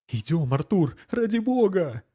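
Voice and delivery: high pleading cry; frightened plea. Acted. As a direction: beg him urgently to leave. Wife pleads urgently. (испуганная мольба)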